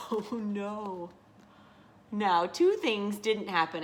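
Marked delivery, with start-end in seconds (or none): 0.1-1.1 mock dread. Dreads the birds' fate.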